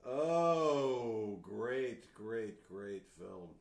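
sarcastic. Pretend. Mock praise of the film.

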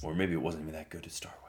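fast whispered afterthought; whispered aside. Real, not acted. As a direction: mutter it under your breath, quickly. Self-deprecating aside.

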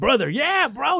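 high excited bro greeting; imitating a thrilled friend. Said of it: "He got excited".

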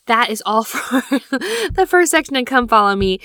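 laughing through the words. Real, not acted. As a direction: let a laugh break the line. Laughs at own emotion.

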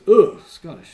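loud scoff of distaste; mock disgust. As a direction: imitate an offended Brit, loudly. Brit rejects being called Scottish.

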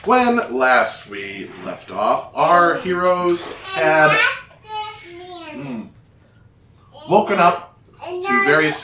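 slow, measured recap voice; a slow dramatic recap opening; old radio drama narrator. A narrator's reading. Opens the recap.

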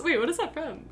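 ordinary delivery

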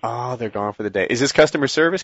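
ordinary talk, nothing marked

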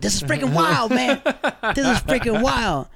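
high, awed exclaiming; young him stunned by his dad's ceremony, awed exclaim; amazed. Retold or imitated. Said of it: "I was like".

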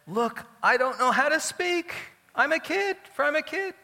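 put-on high-pitched, loud kid's protest; Jeremiah. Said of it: "Yirmiyahu said".

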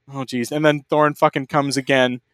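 ordinary delivery

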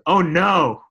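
loud, high-pitched feigned panic; mock alarm. Kid winding up his mom in the car, mock alarm. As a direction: cry out in exaggerated alarm.

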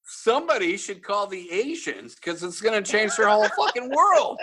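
high, laughing mock outrage; sarcastic rant breaking into laughter; laughing sarcasm. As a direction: barely hold it together laughing.

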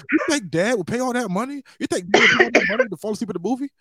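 put-on high, quick indignant kid voice; kid defending dad. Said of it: "He like".

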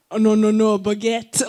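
a jokey hint of a French accent; loud put-on French voice; playful French caricature; mock French accent. Shown as a demonstration. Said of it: "like, with the French".

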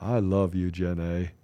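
low, soft Southern movie-character impression; Tom Hanks. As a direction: drop the pitch and do a simple-hearted Southern drawl. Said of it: "I'm hearing some Tom Hanks in that".

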